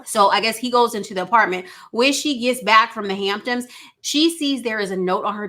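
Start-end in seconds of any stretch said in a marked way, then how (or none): none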